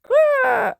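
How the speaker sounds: put-on high, loud toddler growl; roar. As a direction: growl like a little dinosaur. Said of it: "roared George".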